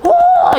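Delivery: loud, very high mock "ooh"; imitated schoolgirl squeal. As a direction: squeal like schoolgirls hearing great news. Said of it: "여학생들은". (여학생 흉내 내는 높고 큰 환호)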